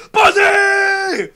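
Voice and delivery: very high, drawn-out scream; imitated scream. Counselor checking on kids in the pool, imitated scream. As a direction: scream it out like a counselor after the whistle. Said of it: "He screams out".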